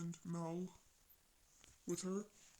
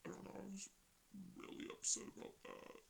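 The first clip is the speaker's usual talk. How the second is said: hushed confession, almost unvoiced; breathy aside; whisper; 9 dB quieter than usual. Real, not acted. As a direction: whisper it like a secret. Admits being upset, aside.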